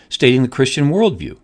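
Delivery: ordinary talk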